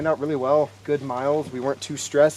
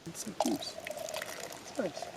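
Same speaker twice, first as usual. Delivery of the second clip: whispering; 2 semitones higher, 16 dB quieter, much slower than usual. Real, not acted. [name] called on to comment.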